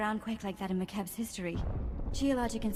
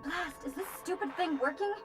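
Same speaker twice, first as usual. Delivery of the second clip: frustrated muttering at device; 8 semitones higher than usual. Acted. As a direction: fret at a comm that won't work. Fumbling with a faulty comm.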